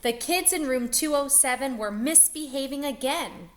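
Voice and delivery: animated storybook narration; reading to children. A narrator's reading. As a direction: read it like a bedtime picture book. Reading picture book aloud to children.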